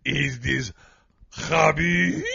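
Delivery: high, mock Russian Putin voice; hammed-up Russian Putin on the phone. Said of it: "Putin called him".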